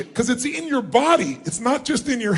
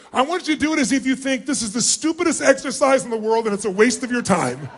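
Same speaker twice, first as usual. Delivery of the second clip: usual speaking voice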